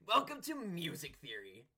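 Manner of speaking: hammed-up host greeting, high and loud; mock YouTuber intro. Host parodying a channel intro for his co-host, loud and hammy. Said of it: "I don't like your impression".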